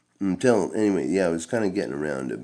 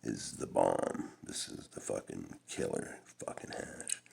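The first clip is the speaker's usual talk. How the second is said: friend's boast; 7 semitones lower, 9 dB quieter than usual. Retold or imitated. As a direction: savour it like a connoisseur. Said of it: "And he's like".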